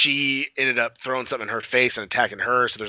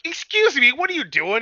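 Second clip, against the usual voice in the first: indignant; 14 semitones higher, 5 dB louder than usual. Retold or imitated. Woman catching him sniffing.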